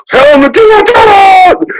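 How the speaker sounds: high shrieking cry for help; mock cry for help. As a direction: squeal out, hammed up. Acted cry of a hugged person.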